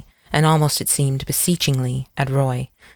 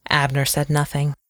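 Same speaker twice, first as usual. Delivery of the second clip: plain reading voice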